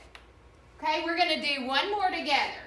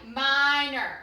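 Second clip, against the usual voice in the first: ordinary talk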